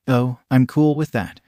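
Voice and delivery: ordinary delivery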